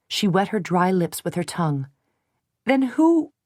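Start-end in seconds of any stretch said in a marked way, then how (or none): 2.6-3.3 high, louder, afraid; startled question. A narrator's reading. Frightened heroine asking who leaked it, high. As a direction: gasp out the question.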